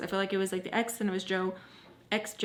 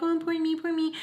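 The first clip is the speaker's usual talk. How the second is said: high, self-mocking whine; mock complaining; 8 semitones higher than usual. Scolding herself for complaining, mock self-pity. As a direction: put on a high self-pitying voice. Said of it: "you wanna complain".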